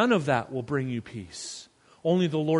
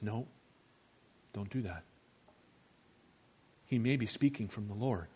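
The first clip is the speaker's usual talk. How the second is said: calm restraint; 5 semitones lower, 10 dB quieter than usual. Retold or imitated. David calmly refusing.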